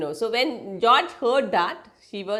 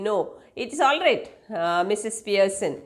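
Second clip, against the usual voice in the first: normal talking voice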